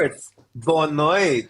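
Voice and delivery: "boa noite" slowly, syllable by syllable; "noite" drawn out and rising; slow teaching pronunciation. Shown as a demonstration. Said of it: "So similar".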